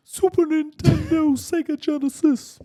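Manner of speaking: first stab at a high-pitched rapper voice, throat not yet clear; drawn-out mock rapper squeal. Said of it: "do in your [name] voice".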